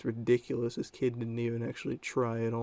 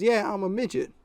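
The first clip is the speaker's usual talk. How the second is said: put-on high, louder boy voice; imitated kid; 9 semitones higher, 6 dB louder than usual. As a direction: mimic a kid bluffing in a high voice. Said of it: "he goes".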